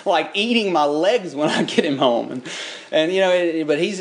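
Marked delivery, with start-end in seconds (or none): 0.0-2.3 incredulous dad. Retold or imitated. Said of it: "I'm like".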